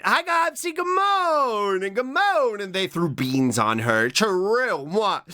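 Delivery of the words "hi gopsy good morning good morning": high, slow sing-song good morning; high cutesy greeting. Retold or imitated. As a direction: stretch the morning out, sweet and high. Quoting the greeting meme.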